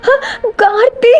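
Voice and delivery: high tearful gasp of relief; acted sobbing. A narrator's reading. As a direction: cry out the name through tears. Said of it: "தேம்பி தேம்பி அழுதுட்டே இருக்கா".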